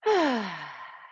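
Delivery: long audible exhale through an open mouth; open-mouth sigh. Shown as a demonstration. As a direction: sigh it all out, falling away. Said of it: "sigh it out, open mouth".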